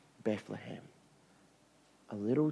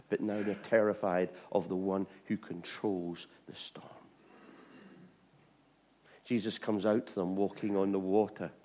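ordinary talk, nothing marked

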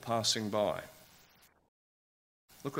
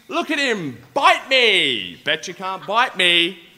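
loud, higher, cocky jeering; mocking taunt; 8 semitones higher, 12 dB louder than usual. Fool taunting a real lion, loud cocky jeer. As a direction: goad the lion like a smug fool. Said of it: "he's thinking".

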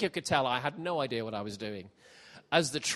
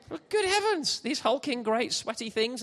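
mock astonishment; 8 semitones higher than usual. Mock amazement at young people.

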